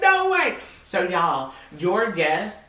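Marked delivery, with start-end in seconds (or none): 0.0-0.6 high, loud amazed exclamation; imitated excitement. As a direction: re-enact thrilled disbelief. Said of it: "She was so excited".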